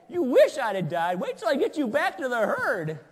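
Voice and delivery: loud, blustering comeback; mock threat. Shepherd threatens his sheep for wishing him dead, loud bluster. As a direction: scold the animal with comic bluster.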